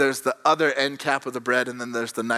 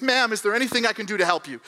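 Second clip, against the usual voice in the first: lightly voiced waiter, higher and quick; quick, higher-pitched polite offer; 6 semitones higher, faster than usual. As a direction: imitate a helpful waiter, hurried. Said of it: "the waiter comes over".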